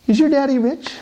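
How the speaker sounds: put-on high, eager little-girl question. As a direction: play a small girl quizzing her friend. Rich girl asks on playground.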